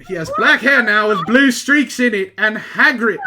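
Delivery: loud, laughing through the words; reading aloud, breaking into loud laughter; laughing while reading. Real, not acted. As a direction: crack up mid-sentence. Reading absurd fanfic, cracking up.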